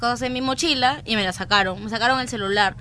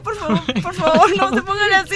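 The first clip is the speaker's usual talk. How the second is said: retold scared plea; 7 semitones higher, 4 dB louder than usual. Scared teller pleading, retold.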